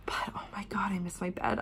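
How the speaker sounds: soft breathy whisper, almost to herself. Real, not acted. Tired traveller whispering she misses her bed. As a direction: hush it like a confession.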